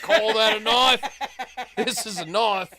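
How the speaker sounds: laughing, hammed-up Aussie boast; mock Crocodile Dundee. To everyone in Australia, a laughing mock Aussie knife boast. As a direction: puff up like a swaggering Aussie. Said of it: "go up to everyone and be like".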